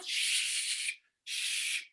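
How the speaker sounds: soft, voiceless "shh"; urgent shush. Retold or imitated. Son shushing his dad in the cinema, hushed. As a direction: hush a noisy companion.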